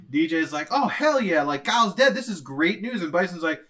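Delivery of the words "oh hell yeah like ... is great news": excited henchman. Retold or imitated.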